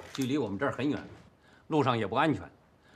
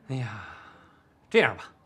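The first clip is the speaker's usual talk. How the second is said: ordinary talk, nothing marked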